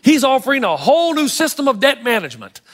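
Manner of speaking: usual speaking voice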